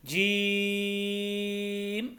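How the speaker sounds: जीम with a very long, level ee; greatly lengthened. Shown as a demonstration. Teacher shows three to five alifs, जीम stretched long. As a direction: hold the ee in जीम before closing on m. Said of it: "तीन से पाँच अलिफ के बराबर लंबा करके".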